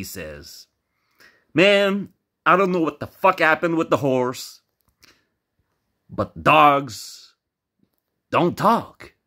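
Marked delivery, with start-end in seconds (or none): 1.5-9.1 high, loud, insistent outburst; exasperated protest. Acted. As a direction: argue back in acted disbelief. Taliban protests in joke.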